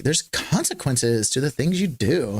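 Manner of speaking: slow mock liberal accent, emphasis misplaced; hedging liberal drawl, parodied; liberal dialect. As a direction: imitate the liberal TV dialect, stretching it out. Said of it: "this certain dialect where they're like".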